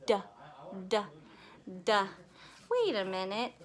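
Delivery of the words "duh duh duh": "D" said as short "duh"; letter sound. Shown as a demonstration. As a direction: tap out "D" as separate duh sounds. Demonstrates sound of D.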